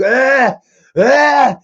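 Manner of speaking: two hammed-up shouts of disgust; imitated yells. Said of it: "he's like".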